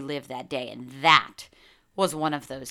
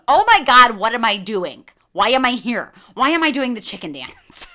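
loud, high disbelief at herself; exasperated inner shout; 6 semitones higher, 12 dB louder than usual. Retold or imitated. To herself while doing the chicken dance, exasperated shout. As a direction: yell it at yourself, mortified.

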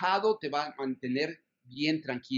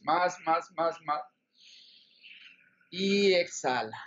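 urging more, more on the inhale; slow breath counting. Shown as a demonstration. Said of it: "Vamos a hacerlo".

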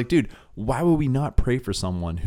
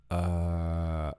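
a low, soft, hesitant "uh, okay"; reluctant; 6 semitones lower, 8 dB quieter, much slower than usual. Retold or imitated. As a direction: answer like you don't really want to. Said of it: "so reluctantly".

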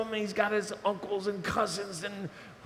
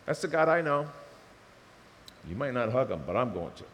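ordinary talk, nothing marked

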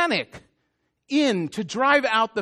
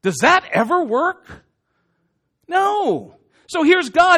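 usual speaking voice

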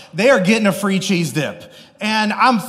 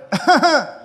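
higher awkward chuckle; embarrassed high chuckle; nervous laugh; 8 semitones higher, 3 dB louder than usual. Retold or imitated. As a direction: laugh off the blunder. Laughs after boss's reply.

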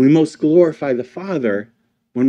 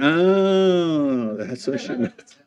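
realization; 5 dB quieter, slower than usual. Retold or imitated. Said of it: "one day I went".

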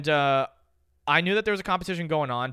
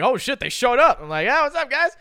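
excited greeting; 7 semitones higher, 5 dB louder than usual. Retold or imitated. Acts out crowd greeting their arrival.

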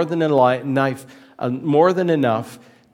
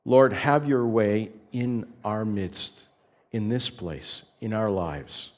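prayer; 2 semitones lower than usual. Acted.

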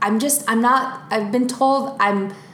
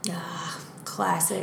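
softer, slower whispered "classic"; a knowing little aside under her breath; 5 semitones lower, 11 dB quieter, slower than usual. Acted. Daughter hearing her dad is golfing again, whispered wry aside. Daughter on dad's golf habit.

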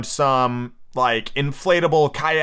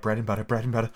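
quiet; 2 semitones lower, 6 dB quieter, much faster than usual. A narrator's reading. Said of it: "kept repeating something in a whisper".

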